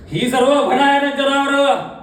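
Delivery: high, slow, drawn-out stage cry; sustained high dramatic cry; horrified outcry. Acted. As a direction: wail the horror out to the theatre. Horror bursts out.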